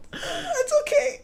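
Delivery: high, slow, trembling little voice; sad child. Retold or imitated. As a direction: brave but tearful kid. Child accepting being sent away.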